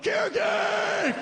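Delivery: very high, slow, stretched shriek; shrieking it alone in his Miata, very high and drawn out; gleeful shout. Pretend. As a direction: scream it out in joy.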